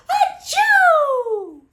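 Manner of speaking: huge, high gasping build into a loud achoo; bear's enormous sneeze; giant sneeze. Acted. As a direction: wind up and explode the sneeze. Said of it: "makes the bear".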